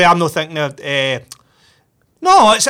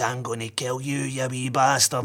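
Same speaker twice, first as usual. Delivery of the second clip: low, quiet, slow wolf threat; menacing wolf voice; 8 semitones lower, 12 dB quieter, slower than usual. Retold or imitated. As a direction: growl it like a puppet wolf. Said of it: "it comes out and it goes".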